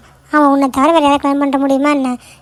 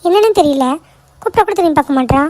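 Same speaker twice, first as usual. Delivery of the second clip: normal talking voice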